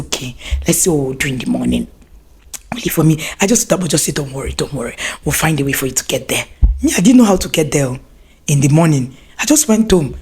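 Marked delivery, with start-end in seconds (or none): 0.0-6.5 low, soft reassuring; calm uncle. Retold or imitated. Uncle calming his upset niece, low and soft. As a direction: soothe her quietly. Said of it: "my uncle said".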